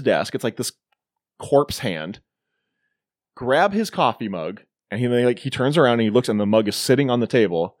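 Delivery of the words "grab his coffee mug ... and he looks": ordinary talk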